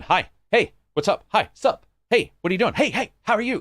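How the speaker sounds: over-eager greetings, high and loud; high-pitched, overdoing friendly hellos; idiotic greeting spree. Acted. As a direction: fire off chirpy hellos like an idiot. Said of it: "you sound like a fucking idiot".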